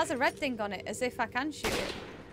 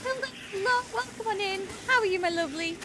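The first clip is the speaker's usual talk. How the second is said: sweet high greeting; 8 semitones higher, 3 dB louder than usual. Real, not acted. Greeting stream viewer brightly.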